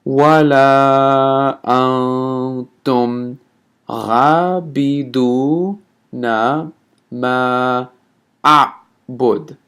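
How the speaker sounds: extremely slow chanted recitation with pauses; teacher repeats the verse slowly. Shown as a demonstration. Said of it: "let me say this one more time slowly".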